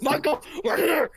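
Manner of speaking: loud, high, stretched-out imitation shout; yelling at the phone. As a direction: imitate an exasperated dad hollering into a phone. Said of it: "he was yelling at the phone".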